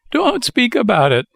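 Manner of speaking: very high wailing puppet voice. A narrator's reading. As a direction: wail it out still shaken. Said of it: "wailed Pinocchio".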